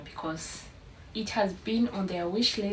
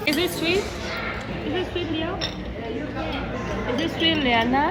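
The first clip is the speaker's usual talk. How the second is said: bright coaxing question; 6 semitones higher, 4 dB louder, faster than usual. Real, not acted. Mother asking her little kids about ice cream, bright and coaxing.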